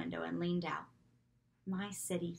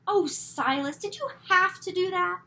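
high, louder dismayed scolding; exasperated at cat; 11 semitones higher, 10 dB louder than usual. A narrator's reading. Girl dismayed at the cat killing mouse, high and exasperated. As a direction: chide the cat.

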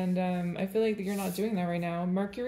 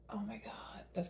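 breathless hushed whisper; real whisper; 14 dB quieter than usual. Real, not acted. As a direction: let it slip under your breath. Caught thinking aloud, whisper.

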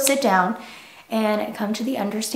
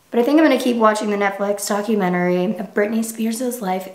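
ordinary talk

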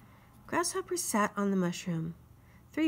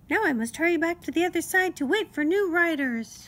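high, louder little-insect voice, officious; fussy mosquito; 10 semitones higher, 6 dB louder than usual. Acted. Mosquito insists on the ferry rule, high and officious. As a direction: lay down the law like a tiny self-important official.

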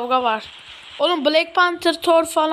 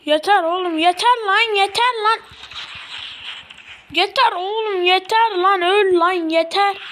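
frustrated yelling; 6 semitones higher than usual. Real, not acted. Gamer shouting at enemies to stop attacking, furious. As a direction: yell at the game to make it stop.

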